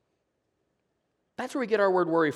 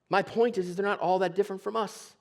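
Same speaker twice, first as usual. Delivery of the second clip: ordinary talk, nothing marked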